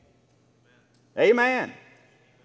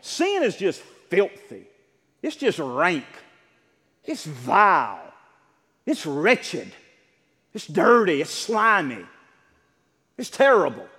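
slow, punched adjectives with pauses between; emphatic denunciation; slower than usual. Real, not acted. Preacher telling congregation how God views sin, emphatic denunciation. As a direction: land hard on each damning word.

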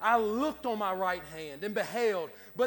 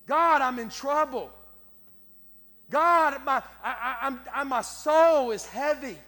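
loud pleading cries to God; crying out in prayer; 3 semitones higher, 6 dB louder than usual. Retold or imitated. Preacher voicing David's desperate cry to God, loud. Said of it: "David is saying".

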